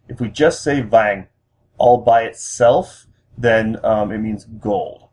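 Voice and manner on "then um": ordinary talk, nothing marked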